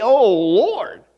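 a marveling Oh, Lord with a rising Oh; awed exclamation. Shown as a demonstration. Preacher to congregation, amazed Oh, Lord. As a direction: say it in awe, lifting the Oh. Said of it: "You could say that like that too".

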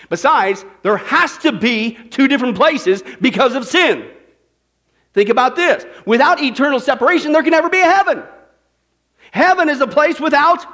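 high, loud pulpit shouting; fired-up revival preacher; full-force preaching. Acted. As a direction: build to a whooping, stretched-out cry.

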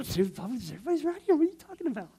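very high, frantic squeal; squeaky imitation of embarrassed disbelief; mock panicked protest. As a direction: object in hammed-up alarm. Acts panicked objection to singing publicly.

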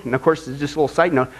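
ordinary talk

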